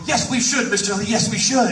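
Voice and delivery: high-pitched eager agreement, re-enacted; giddy. Fan agreeing to his idol's movie idea, giddy. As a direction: gush like a starstruck kid. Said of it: "like, giddy".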